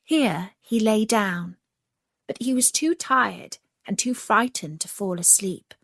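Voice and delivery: ordinary delivery, nothing marked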